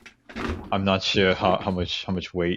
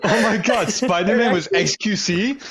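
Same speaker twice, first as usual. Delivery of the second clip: high, loud voice through laughter; laughing disbelief; 12 semitones higher, 4 dB louder than usual. Real, not acted. Friend cracking up at a costume joke, laughing disbelief. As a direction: crack up while blurting it out.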